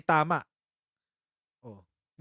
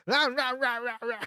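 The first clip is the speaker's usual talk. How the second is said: mock ranting noise; 7 semitones higher than usual. Host impersonating offended critics, mock ranting. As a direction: imitate angry critics as wordless yapping. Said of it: "Mag-impersonate nga tayo".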